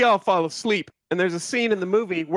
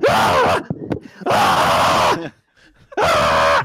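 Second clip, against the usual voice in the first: imitated movie screams; 11 semitones lower, 7 dB louder, much slower than usual. Retelling the movie scene to friends, loud panicked screams. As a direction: belt out the coffin man's terrified screams. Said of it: "you just hear, like".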